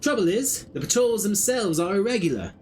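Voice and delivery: put-on a slipping country accent, a hint only; half-held rustic English accent; wavering rustic accent. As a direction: drop your g's like a rough scout, accent coming and going. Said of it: "I kept switching accents".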